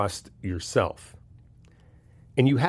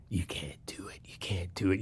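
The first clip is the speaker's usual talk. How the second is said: low, sneering whisper of the doubting voice; inner critic's whisper; 3 semitones lower, 12 dB quieter than usual. Pretend. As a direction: hiss the doubts like a taunting inner demon. Inner bad guy voiced.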